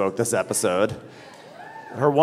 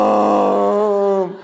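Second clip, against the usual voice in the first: calling mom; 8 semitones higher, 6 dB louder than usual. Retold or imitated. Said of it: "hear Melania go".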